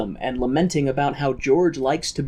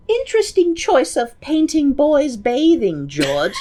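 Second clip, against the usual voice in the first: high, loud mock-snooty voice; mock society lady, much higher and louder; posh rich couple voice; 13 semitones higher, 5 dB louder than usual. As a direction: ham up a snobby rich lady.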